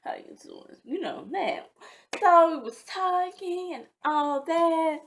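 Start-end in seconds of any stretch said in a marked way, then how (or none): 0.0-0.8 hushed, whispery flirtation; sexy phone voice. Shown as a demonstration. Woman showing the voice she used on calls with him, sexy. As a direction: drop to a sultry whisper. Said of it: "talk a little bit more sexy".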